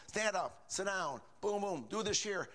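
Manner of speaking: imitating a mechanical church service; mock mechanical routine. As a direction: reel off orders like an empty routine. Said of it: "They're so mechanical".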